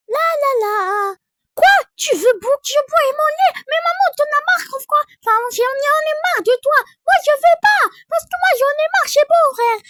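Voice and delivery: put-on high, loud little child fed up with mom; cheeky toddler voice. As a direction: play the sulky small kid talking back. Mom plays her toddler Zion.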